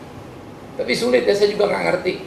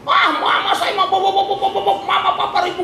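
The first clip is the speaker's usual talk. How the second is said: put-on child's wail; 14 semitones higher than usual. Preacher voicing a child who screams at him about his mama and papa, high wailing. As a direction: cry out like a kid who is suddenly upset. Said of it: "langsung dia teriak".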